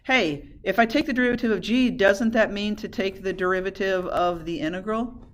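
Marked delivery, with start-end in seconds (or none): none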